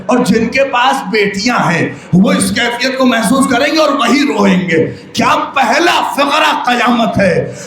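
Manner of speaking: high, ringing lament at full force; impassioned majlis preaching. Acted. Zakir to the mourners, grieving and building. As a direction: preach it out to make the mourners weep.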